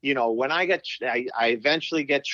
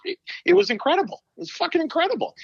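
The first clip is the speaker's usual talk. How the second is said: incredulous outburst; faster than usual. Real, not acted. Disbelief about Keenan's coaching.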